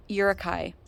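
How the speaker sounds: Yurikai, opening on a soft "y" glide and ending in "kai"; careful local pronunciation. Shown as a demonstration. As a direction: say Yurikai with a Y sound at the start, like "yeh-ruh-kai". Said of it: "exactly how she pronounced it".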